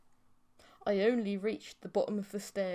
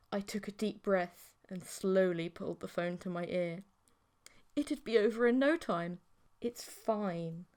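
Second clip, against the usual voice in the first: ordinary delivery, nothing marked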